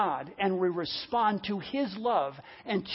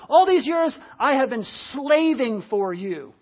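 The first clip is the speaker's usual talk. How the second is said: slow, drawn-out grievance; resentful complaint; 2 semitones higher, 4 dB louder than usual. Acted. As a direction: lean hard and long on "slaving". Acts out the resentful brother.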